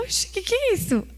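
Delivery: a father jolted by a noise in the yard; surprised father. Retold or imitated. Father hearing a noise at dawn, startled high exclamation.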